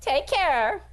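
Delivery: high, loud sing-out goodbye; friendly hollered send-off; farewell call. Acted. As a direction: call back cheerily while leaving. Skinny calls a farewell.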